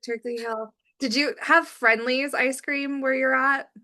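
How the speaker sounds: ordinary talk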